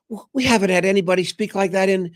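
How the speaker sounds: very high, put-on cutesy lady; mock high sweet voice, gushing praise; cute woman's voice. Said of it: "in the cutest of ways".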